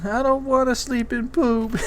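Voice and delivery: imitating a pouting child; whiny little kid. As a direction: play a sad little boy admitting it. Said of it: "And he's like".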